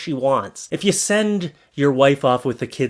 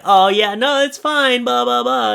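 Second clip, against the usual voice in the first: casual mock brush-off; 9 semitones higher, 3 dB louder than usual. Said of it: "he was like".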